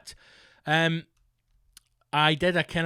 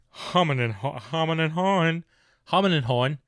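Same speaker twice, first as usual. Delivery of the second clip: put-on humming and hawing in a broad Scottish accent, a bit higher; Scottish saying; 3 semitones higher, 4 dB louder than usual. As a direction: recite it like an old Scottish proverb in a thick Scottish accent. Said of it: "a good old Scottish saying".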